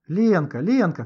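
loud alarmed calling of a name; shouting. A narrator's reading. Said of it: "кричал он".

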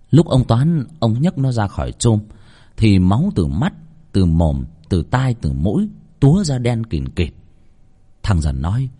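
ordinary delivery, nothing marked

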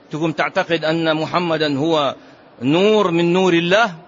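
ordinary talk, nothing marked

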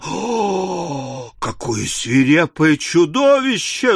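gasped opening, then aghast exclamation; mild squeal of horror. A narrator's reading. Another teacher aghast at the intruder, squealing. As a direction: recoil with a horrified little squeal. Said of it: "взвизгнула другая воспитательница".

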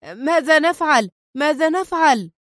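high, panicked repeated question; terrified cry. A narrator's reading. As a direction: cry out in helpless alarm. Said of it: "هاتفا في رعب".

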